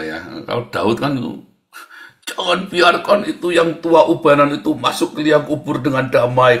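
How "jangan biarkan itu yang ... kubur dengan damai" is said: King David's order. Retold or imitated. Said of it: "katanya Daud ya".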